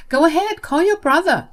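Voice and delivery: high, loud mocking challenge; taunting dare. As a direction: go on, I dare you. Luna taunts Jenny.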